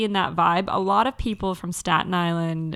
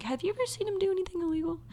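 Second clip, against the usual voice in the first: interviewer voice; 10 semitones higher, 8 dB quieter, much faster than usual. Retold or imitated. Mocking an interviewer in a retold scene.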